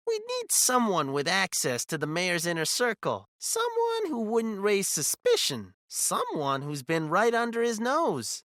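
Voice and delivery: high, eerie visitor voice. A narrator's reading. Visitors recruiting Alex against the mayor, eerily. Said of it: "their voices harmoniously eerie".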